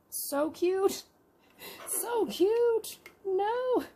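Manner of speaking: high, squealing "so cute" over and over; gushing cuteness. Real, not acted. Collector gushing over a tiny jar of glowing mushrooms, high-pitched squeal. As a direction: let the pitch fly up as the cuteness overwhelms you.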